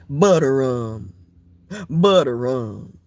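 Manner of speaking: low and slow; low slow drawl. Acted. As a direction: drag it out deep. Low slow comic mumble.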